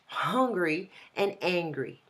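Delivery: slow, with the H sounded in "Hungry" and no H in "angry"; teacher demonstrating "Hungry" against "angry"; careful contrast. Shown as a demonstration. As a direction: spell out the H difference slowly. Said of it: "It's important to pronounce the H in English".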